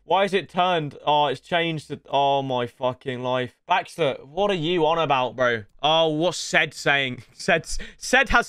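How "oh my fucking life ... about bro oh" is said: ordinary talk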